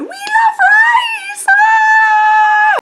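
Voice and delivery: high shrieking fangirl cheer; fangirl screaming. Retold or imitated. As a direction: scream it like a fan at a concert. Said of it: "I would be at the Harvest show going".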